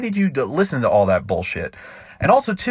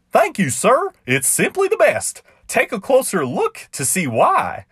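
much higher, sweetly innocent clown; high sly clown voice; 11 semitones higher than usual. Acted. Clown luring the cowboy to sniff the flower, high and sweet. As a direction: sound harmless while springing the trick. Clown voice again, setting trap.

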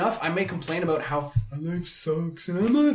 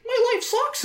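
put-on much higher, whining little-boy voice; whiny young boy; slower than usual. Mocking his younger self complaining to his boss, whiny and high. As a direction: whine it like a sulky kid. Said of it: "young little boy who's like".

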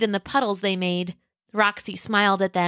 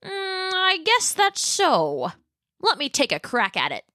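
Pat the dinosaur; 8 semitones higher than usual. Acted. Pat voiced by narrator.

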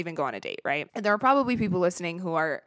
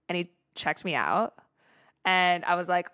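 normal talking voice

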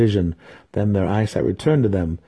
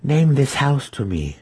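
desperate matron pleading with the demon; 7 semitones higher than usual. A narrator's reading. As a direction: beg for the name. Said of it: "Malice pleaded".

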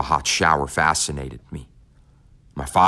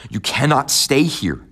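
higher, louder and slow insistent plea; urgent plea; 10 semitones higher, 3 dB louder than usual. Acted. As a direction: drag each word out to make him listen. Son begging father to move.

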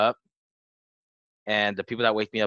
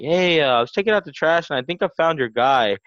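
raised, projected voice of a retold bystander; Domino's guy calling out; 6 semitones higher, 6 dB louder than usual. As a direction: imitate a worker calling over, high and loud.

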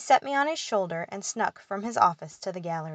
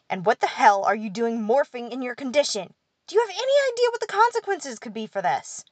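loud, rising higher to an outraged pitch; angry rant; 5 semitones higher, 5 dB louder than usual. A narrator's reading. Pixie ranting at his fairy for morphing. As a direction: berate him, climbing higher in outrage. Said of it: "pointed his blue finger at me angrily".

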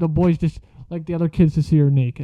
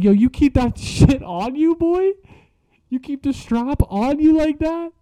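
much higher, slow, laughing disbelief; amused disbelief; 10 semitones higher than usual. Retold or imitated. To the girl with the ankle brace, high laughing disbelief.

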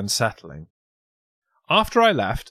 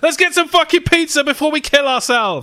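loud, high, fed-up cry; exasperated shout; 14 semitones higher, 7 dB louder than usual. Retold or imitated. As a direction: blurt it out in despair. Acted group member's outburst.

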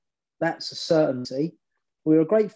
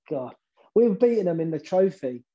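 ordinary talk

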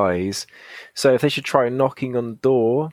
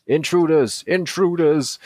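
robot guard alarm; 6 semitones higher, slower than usual. Acted. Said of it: "That's what he sounds like".

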